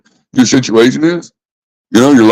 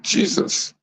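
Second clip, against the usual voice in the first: breathy exasperated whisper, much softer; whispered Jesus; 11 dB quieter than usual. Real, not acted. Frustrated vlogger mutters under his breath in exasperation. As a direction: let it out under your breath, fed up. Exasperated aside mid-rant.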